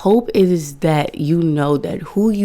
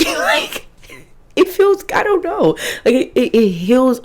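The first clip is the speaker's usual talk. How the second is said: laughing; 9 semitones higher than usual. Real, not acted. Laughing after the fake cry.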